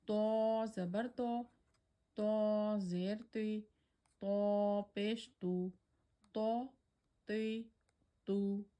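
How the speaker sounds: to said heavy, mouth full and back; thick to. Shown as a demonstration. Teacher demonstrating heavy mustalia to for students. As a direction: give to a full, thick emphatic sound. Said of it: "we'll pronounce it in a thicker voice".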